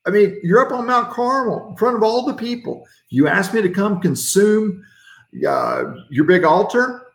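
an impatient God listing everything he did; exasperated God. Pretend. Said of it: "That's what I would have said".

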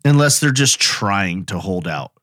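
usual speaking voice

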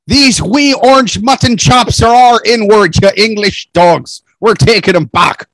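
hammed-up Irish brogue, proud and defiant; mock Irish brogue. Acting defiant Irish fan.

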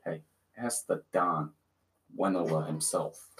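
low and slow like a mob boss; mock mafia don.

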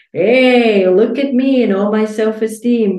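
playful boastful hey; mock triumph. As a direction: show off like an overnight success. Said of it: "suddenly be like".

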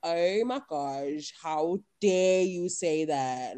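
very slow, drawn-out mock offence; dumb blonde. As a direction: act the scandalized airhead. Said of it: "I used to do that in the middle of the taxi".

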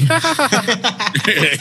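high, open laughing; burst of laughter. Real, not acted. Friends joking about Harry Potter, high laughter.

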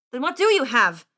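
loud, impatient official; scornful scribe. Acted. Scribe losing patience with squire, scornful. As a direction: demand it loudly, out of patience.

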